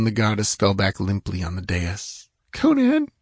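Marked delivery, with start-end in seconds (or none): 2.5-3.1 very high, plaintive little call; faint whimper. A narrator's reading. Exhausted false goddess calling to her hidden ally, whimpering. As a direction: whimper the name for help. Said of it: "she whimpered faintly".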